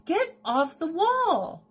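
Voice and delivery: high drawn-out scolding to the cat; high slow scolding. Real, not acted. Owner scolding the cat on the wall, high and drawn out. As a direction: stretch it out high like telling off a pet.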